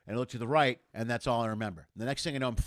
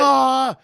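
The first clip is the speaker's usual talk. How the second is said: short high mock scream of alarm; imitated panicked scream; 12 semitones higher, 17 dB louder than usual. Onlookers see him badly hurt, hammed-up scream. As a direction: yelp like a horrified onlooker.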